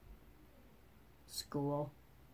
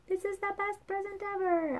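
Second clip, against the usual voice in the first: put-on high, soft, thrilled little-girl voice; child hushed with birthday delight; 11 semitones higher, 8 dB quieter than usual. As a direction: breathe it out like the best gift ever.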